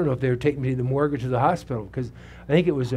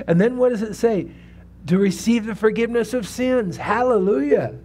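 higher, louder fervent preaching; preaching at full force; 7 semitones higher, 3 dB louder than usual. Acted. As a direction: rouse the congregation with each line. Preaching the verse to congregation.

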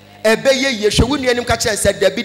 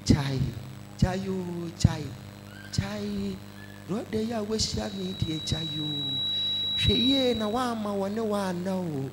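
tempting voice; 3 semitones lower, 12 dB quieter than usual. Acted. Preacher voicing a worldly tempter.

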